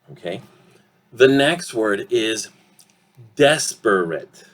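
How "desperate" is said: DESPERATE drawn out as des-per-et; DESPERATE slowly with all three vowels; slow, syllabic. Shown as a demonstration. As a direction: sound out each syllable of DESPERATE. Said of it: "If I say it slowly".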